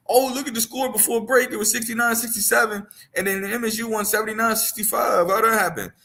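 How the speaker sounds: high-pitched mock whining complaint; complaining fans. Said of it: "I saw people complaining".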